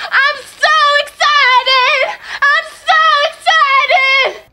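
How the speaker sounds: high, very loud, drawn-out squeal of excitement; over-the-top excited shriek; wildly excited. Real, not acted. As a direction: scream it out, stretching every word. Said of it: "this I mean I'm excited".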